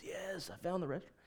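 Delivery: very faint, dry rasp; acted weak, thirsty rasp with tongue hanging out; raspy parched voice. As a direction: croak it softly, as if dying of thirst. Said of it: "raspy voice, tongue hanging out of his mouth".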